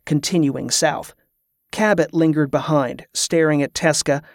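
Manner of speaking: plain reading voice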